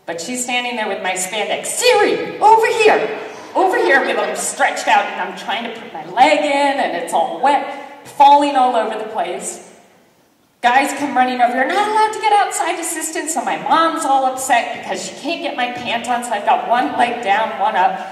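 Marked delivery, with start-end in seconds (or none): 1.7-4.0 high, loud call across the pool; mom calling out. Retold or imitated. Mom at the pool calls her daughter over, high and loud. 11.5-13.3 officials scolding. Retold or imitated.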